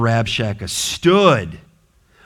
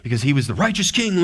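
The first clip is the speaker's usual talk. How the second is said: ordinary delivery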